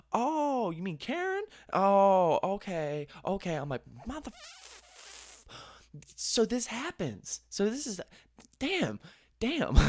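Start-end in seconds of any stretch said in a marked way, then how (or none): 0.1-3.6 high, drawn-out "oh" of recognition; mock knowing chorus. Hosts react to the hostess's name, high knowing tone. 4.1-9.8 high, slow, strained squeak; mock exasperated disbelief. Storyteller learns hostess is known for this, high exasperated squeak. Retold exasperation about Karen.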